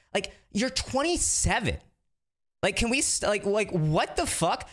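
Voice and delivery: furious disbelief at what he's hearing; real exasperation. Real, not acted. Ranting about the circle's behaviour.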